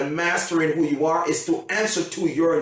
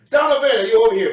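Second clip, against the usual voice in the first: fast, high, loud name call; 6 semitones higher, 7 dB louder, much faster than usual. Acted. Present giver looking for Donovan, calling out quickly. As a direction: holler quickly for the next person.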